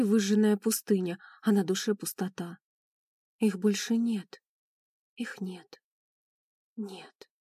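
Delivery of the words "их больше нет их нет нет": fading from soft voice to near whisper; slow down and let the grief sink away; grieving. A narrator's reading. Daughter at her parents' fresh graves, grief fading to whisper.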